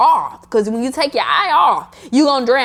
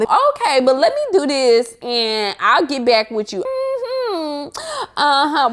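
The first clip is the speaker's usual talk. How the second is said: nicer, much higher sing-song okay; sweet brush-off voice; 4 semitones higher, slower than usual. Shown as a demonstration. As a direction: sweetly wind the chat down. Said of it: "let me get in my voice".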